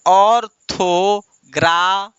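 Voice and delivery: "ऑर्थोग्रा-" slowly split as ऑर, थो, ग्रा, left unfinished; syllable by syllable. Shown as a demonstration. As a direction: lead a class through "ऑर्थोग्रा-" in drawn-out separate beats. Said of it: "बोलिए". ("ऑर्थोग्रा-" धीरे-धीरे: ऑर... थो... ग्रा)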